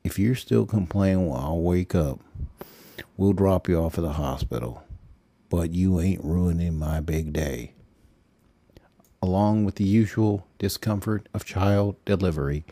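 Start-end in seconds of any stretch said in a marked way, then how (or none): 0.1-7.7 quieter, gentler husband; softened tone. Acted. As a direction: ease off, a bit softer. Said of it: "softened his tone".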